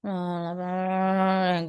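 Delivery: listless, drawn-out drone; limp, listless. Shown as a demonstration. Teacher showing how not to present, limp. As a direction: drag it out with no energy. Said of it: "jangan juga yang lemek".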